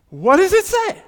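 high, loud, eager question; eager stranger. Retold or imitated. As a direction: pester with bright curiosity. Seatmate keeps asking questions.

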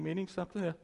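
ordinary talk

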